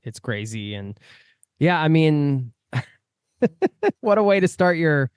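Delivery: ordinary talk, nothing marked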